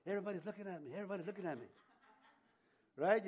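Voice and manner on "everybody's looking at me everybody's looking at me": self-conscious worry. Retold or imitated. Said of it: "you don't think about".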